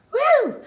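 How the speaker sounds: loud, high celebratory whoop; excited woo. Real, not acted. Host cheering about turning fifty-six, loud whoop. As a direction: cheer for your own birthday. Celebrating her upcoming birthday.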